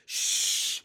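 long, forceful "shh"; loud shushing. A narrator's reading. Friends silencing the noisy centipede, urgent shushing. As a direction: shush them sharply and at length. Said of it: "the others whispered".

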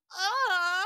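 long, high, loud mock moan; demonstrated moan. Shown as a demonstration. Host reluctantly redoing her moan soundbite, high mock moan. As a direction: draw out a comic squawking moan. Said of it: "how did you do it? It's like".

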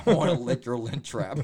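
loud laughing punchline; laughing joke. Real, not acted. Joking with friend about comments, loud laughing line. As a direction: crack yourself up on it.

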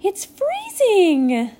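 high, loud, drawn-out exclamation; excited cry. A narrator's reading. Tinkerbell announces the ice to fairies, crying out. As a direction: cry the news out across the lake. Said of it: "heard Tinkerbell cry out".

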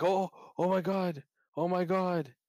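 high, breathless retold excitement; excited gasp. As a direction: gush like a fan seeing the reveal. Retold hyped dream reaction.

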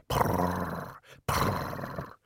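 soft, low rolled purr; cat purr. A narrator's reading. Greebo the cat purring, soft and low. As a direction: trill a quiet purr.

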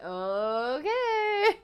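lightly imitated guard's drawn-out okay; a hint of a doubtful guard, stretched out; mimicked security guard. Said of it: "He's like".

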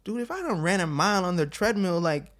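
high, loud mock protest; emphatic refusal. Athlete without a coach quitting the treadmill, loudly protesting. Acts out refusing to run more.

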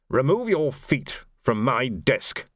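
slow, cold, measured order; icy warning to the insolent sergeant. A narrator's reading. Major to Carcer, slow and coldly. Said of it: "he said coldly".

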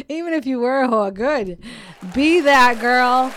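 loud, hyped-up cheer; loud rallying cry. Real, not acted. Cheering on listeners to be bold.

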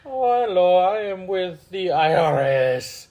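high, drawn-out Indian scammer impression; hammed-up Indian accent, slowing down; mock Indian accent. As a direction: play a scam caller in a high Indian accent. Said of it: "I hear some Indian accent".

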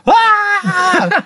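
high, drawn-out mock scream. Imitating the butler engulfed in flames, high mock scream. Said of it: "he's screaming".

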